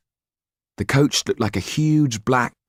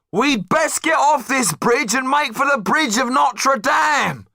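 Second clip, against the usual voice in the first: high, slow call above the noise; shouting over the crowd; slower than usual. Acted. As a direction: bellow over a noisy crowd. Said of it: "Didier shouted above the noise".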